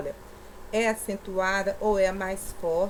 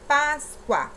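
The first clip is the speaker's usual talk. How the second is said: "Páscoa" higher, weight on "Pás"; stress on first syllable; 4 semitones higher, 4 dB louder than usual. Shown as a demonstration. Teacher showing pupils the penultimate syllable, emphatic "Pás". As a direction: push the opening "Pás" of Páscoa. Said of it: "a penúltima sílaba".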